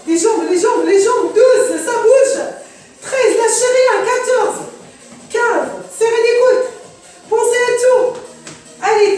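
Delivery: loud, high coach calls pushing the class; shouting encouragement. Real, not acted. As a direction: yell it over the music to keep them moving. Coach yelling encouragement mid-set.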